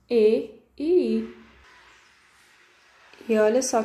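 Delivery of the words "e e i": "E e I" said slowly, each vowel stressed; vowels named. Shown as a demonstration. Teacher names the vowels for the children, slowly. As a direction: spell out "E" and "I" slowly. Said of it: "que são as vogais".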